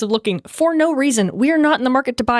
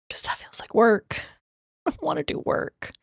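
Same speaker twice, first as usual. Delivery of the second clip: whisper; 2 semitones higher, 5 dB quieter than usual. Real, not acted. Tired streamer muttering aside.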